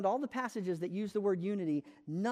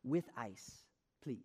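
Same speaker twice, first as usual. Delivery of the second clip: Spanish phrase; 7 semitones lower, 8 dB quieter, slower than usual. Retold or imitated. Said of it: "learned the phrase in Spanish for".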